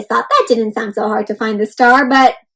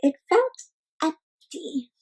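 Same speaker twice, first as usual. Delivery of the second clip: softer, fading to near silence; sad hush; 2 semitones higher, 8 dB quieter, slower than usual. A narrator's reading. Teacher showing Nimbus's loneliness to children, sad and hushed. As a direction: let the word empty fall away. Sad story moment, empty sky.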